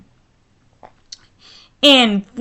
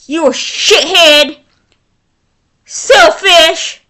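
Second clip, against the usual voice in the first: raised, heated insults at the couple; venting anger; 2 semitones higher than usual. Real, not acted. Vlogger scolding the couple who abandoned their baby, angry. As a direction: let real fury spill into each insult. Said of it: "I'm so mad right now".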